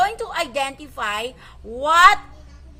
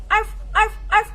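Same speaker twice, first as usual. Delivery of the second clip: imitated dog bark rising higher; 6 semitones higher than usual. Shown as a demonstration. Teacher barks again for the kids to guess. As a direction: bark like a playful puppy. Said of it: "I'm gonna say the sound".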